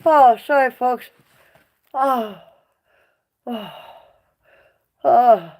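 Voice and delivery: pained loud groans; hurting, groaning through words. Real, not acted. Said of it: "I feel like hammered shit".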